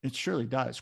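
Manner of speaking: ordinary talk, nothing marked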